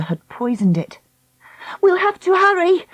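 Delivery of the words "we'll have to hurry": high, alarmed call; urgent cry. Acted. Dakota seeing the turtle poisoned, urgent cry. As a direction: cry it out, pitched up. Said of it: "Dakota cried".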